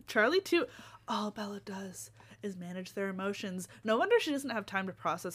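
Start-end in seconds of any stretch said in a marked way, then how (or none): 1.1-3.7 whisper. Acted. Deadpan repeat of complaint.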